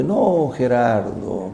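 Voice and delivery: No, Gerardo stretched out very slowly and lazily; imitating an unhurried interpreter; slow relaxed Brazilian. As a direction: drawl it like nothing is ever urgent. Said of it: "porque ellos hablan así".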